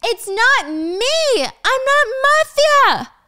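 very high, drawn-out desperate shout; slow, strained high-pitched yell of denial. Acted. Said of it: "Danny shouts".

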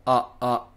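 clipped "あ", said as uh, twice; "あ" like the u in cup; short English uh vowel. Shown as a demonstration. Teacher repeats the vowel of cup, short uh. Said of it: "これがカップのあの音です".

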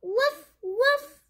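high little woof woof of a puppy; puppy bark. Acted. The puppy Biscuit barking back, high happy woofs. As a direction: yip it like a happy small dog.

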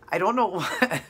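breaking into real laughter mid-line; can't hold back the giggles; words squeezed out through a laugh. Real, not acted. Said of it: "Okay, I'm trying".

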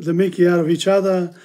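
ordinary talk

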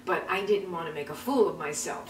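ordinary delivery

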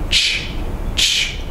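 normal talking voice

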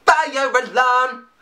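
high, slow drawn-out call; excited echo. Pretend. Vlogger echoing a lyric, high and excited. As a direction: shout the lyric back with glee.

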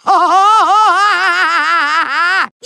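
long maniacal villain cackle; evil laugh. Acted. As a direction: cackle on and on in triumph. Villain gloats over his suit.

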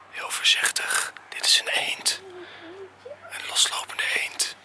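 hushed whisper so the duck stays put; whispering while hiding. Real, not acted. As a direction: whisper softly, creeping closer. Sneaking up on a duck.

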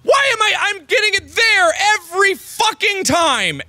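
real frustrated gamer rage; furious shout. Real, not acted. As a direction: yell at the game over and over. Raging after repeated deaths.

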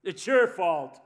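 loud accusing yell; blaming yell. Retold or imitated. Someone blaming the people around them, loud. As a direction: throw the blame at them hard.

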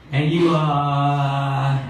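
very slow, stretched And you are; mocking the receptionist, slow sing-song; drawn-out prompt. Shown as a demonstration. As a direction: drag And you are out long. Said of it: "also three syllables".

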